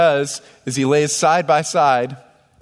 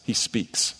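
whispered; 5 semitones lower, 7 dB quieter than usual. Shown as a demonstration. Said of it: "opening line, before same words".

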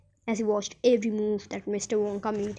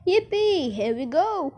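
high whoop easing into a cheer; gleeful shout; 6 semitones higher, 4 dB louder, slower than usual. A narrator's reading. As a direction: cheer the ride on. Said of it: "shouted Grandpa Joe again".

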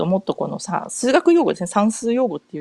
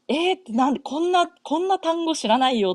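retold bewildered surprise; 9 semitones higher than usual. Acts out shock at unknown words.

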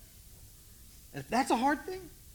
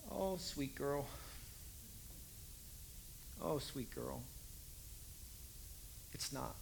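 tender soothing; 6 semitones lower, 12 dB quieter, slower than usual. Retold or imitated. Parent soothing the teen.

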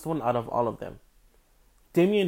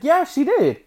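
much higher, louder retold outburst; indignant confirming; 12 semitones higher, 8 dB louder than usual. Helper confirms the shushing to stepbrother, indignant. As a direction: confirm it with loud, high outrage.

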